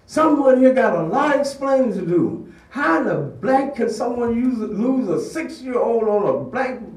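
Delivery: high, loud, frantic yelling; furious mother ranting. Retold or imitated. Irate parent storms into the office, yelling. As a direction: rage at the principal over a lost child. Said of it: "loud and swearing like a drunken sailor".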